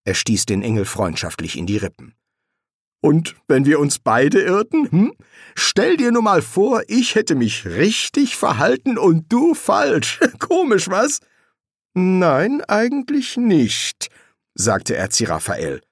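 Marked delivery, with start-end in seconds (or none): 3.0-11.2 high, loud, animated musing; teasing serpent demon, amused. A narrator's reading. Serpent imagining both got it wrong, amused. 12.0-14.1 high, slow, drawn-out refusal; uneasy disagreement. A narrator's reading. Angel answering the serpent's joke, uneasy.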